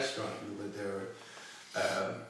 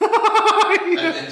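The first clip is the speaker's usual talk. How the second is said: laughing; 14 dB louder than usual. Real, not acted. Laughing setup.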